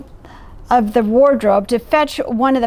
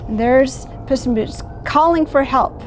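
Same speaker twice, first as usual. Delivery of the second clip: ordinary delivery, nothing marked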